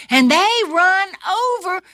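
ordinary delivery

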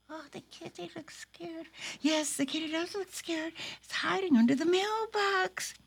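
a worried little puppet. Acted. Puppet cat noticing the scared kitty, soft and quick.

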